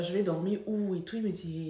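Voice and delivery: usual speaking voice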